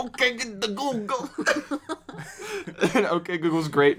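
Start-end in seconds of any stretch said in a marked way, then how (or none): 0.0-1.3 high, goofy celebrity impression; struggling to get out a hammed-up impression; Bill Cosby. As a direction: put on a silly, high comic voice. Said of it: "Bill Cosby saying".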